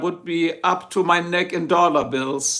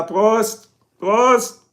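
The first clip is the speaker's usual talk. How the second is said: raised, louder German toast, building on the repeat; hearty toast; 5 semitones higher, 5 dB louder than usual. Real, not acted. Streamer drinking to Lana, hearty toast. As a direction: call out the toast, higher the second time.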